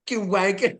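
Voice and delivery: put-on high-pitched English child's voice; little kid English accent. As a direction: play a little British kid cursing. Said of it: "that little kid English accent".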